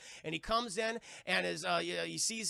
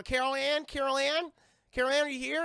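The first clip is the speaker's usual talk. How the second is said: higher, louder calling; 7 semitones higher, 5 dB louder than usual. Retold or imitated. Mother calling for Carol Anne around the house. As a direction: call through the house for the child.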